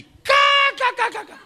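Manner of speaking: explosive, high, very loud cackle; imitated laughing fit. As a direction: burst out in hammed-up uncontrollable laughter. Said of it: "Comecei a rir". (gargalhada exagerada, imitada)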